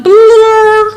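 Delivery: high, loud sheep bleat trying to be a roar. Acted. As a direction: bleat like a lamb attempting to roar. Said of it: "a little bleat roar".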